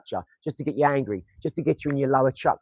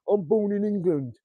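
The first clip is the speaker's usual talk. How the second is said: slow, higher mocking voice; mock bigot; 6 semitones higher, slower than usual. Mocking small-minded people proudly claiming their birthplace. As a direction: sneer it like a small-minded patriot. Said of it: "They're that sort of thinking".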